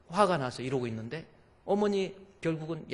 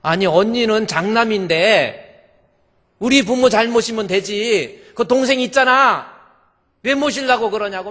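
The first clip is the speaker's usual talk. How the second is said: loud, high protesting rebuke; objecting sisters-in-law; 7 semitones higher, 15 dB louder than usual. Retold or imitated. Sisters-in-law refusing to take in her mother, loud indignant objection. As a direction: act out indignant in-laws at full volume. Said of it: "신우들이 반대하는 거예요".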